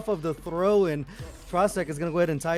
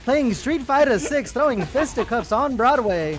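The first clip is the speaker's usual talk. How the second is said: put-on old-time radio announcer, slowed and higher; booming vintage broadcast call; 1940s radio caster voice; 6 semitones higher, 3 dB louder, slower than usual. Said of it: "I gotta put on my old nineteen forties, uh, caster voice here".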